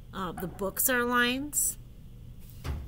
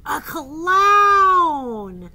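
wailing elephant; 6 semitones higher, 13 dB louder than usual. Acted. Gerald wails in picture book.

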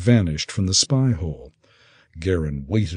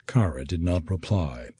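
plain reading voice

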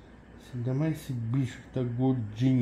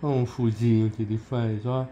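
ordinary delivery

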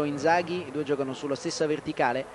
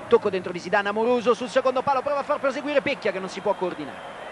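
breathless italian goal commentary; 7 semitones higher, 3 dB louder than usual. Real, not acted. Cross into box, rising call.

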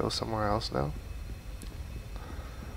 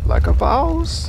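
bragging put-on voice; 9 dB louder, much slower than usual. Bragging after finding coins.

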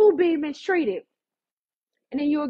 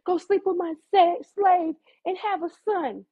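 higher-pitched, theatrical wifely appeal; lightly acted Sarah; 6 semitones higher than usual. Sarah offering Abraham her slave, dramatic. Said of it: "She said".

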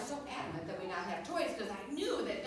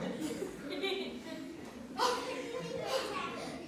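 high, falling laugh; real laughter; 10 semitones higher than usual. Real, not acted. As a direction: laugh at yourself. Laughs at her own story.

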